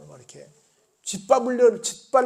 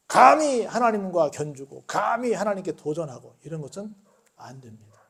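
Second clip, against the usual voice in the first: usual speaking voice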